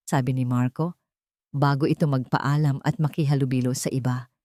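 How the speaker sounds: usual speaking voice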